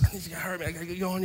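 quiet, polite hurry-up; soft urging. Retold or imitated. Preacher quietly urging a man in the checkout line. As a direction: nudge him softly to move. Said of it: "you know, like that".

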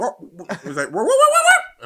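very high, loud climbing wahs; mushroom growing sound. Retold or imitated. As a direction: rise up like a power-up jingle. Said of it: "the sound that he makes when he takes a mushroom".

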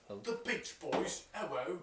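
high, drawn-out English caricature; goofy put-on English accent, stretched out; mock English accent.

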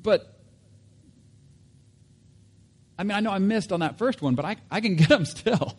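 high, loud pleading objection; acted frantic bargaining; worked-up protest. Acts out Peter's frustrated protest.

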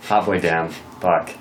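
ordinary talk

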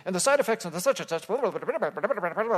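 fast, run-together fine-print read; rushed disclaimer voice. Retold or imitated. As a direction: rattle it off like a drug ad's side effects. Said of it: "talking in this different voice".

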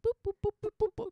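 high bouncy boops, pitch well up; mimicked happy music. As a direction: sing boop like a cartoon tune. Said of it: "There's some happy music".

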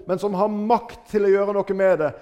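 ordinary talk

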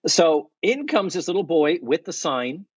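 ordinary talk

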